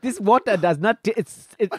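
put-on indignant comic Nigerian accent; Nigerian prince accent. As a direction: protest in a mock Nigerian accent. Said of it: "Do a, do the Nigerian".

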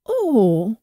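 little dwarf's startled "oh"; mild indignant exclamation. A narrator's reading. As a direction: exclaim it, mildly affronted. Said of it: "rief der Kleine".

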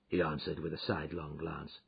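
usual speaking voice

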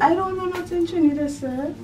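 a light Trinidadian lilt; hint of Trinidad; mock Trini accent. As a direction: try out a Trini accent, half-laughing. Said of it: "do a Trini accent".

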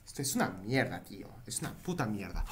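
soft, low grumbled swearing; muttered cursing. Real, not acted. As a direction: mutter it to yourself. Muttering after failure.

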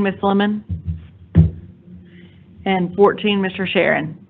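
normal talking voice

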